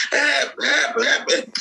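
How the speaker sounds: retold cry for help; mock shouting. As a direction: act out calling for help, not at full volume. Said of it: "So they started shouting".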